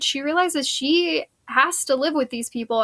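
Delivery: ordinary delivery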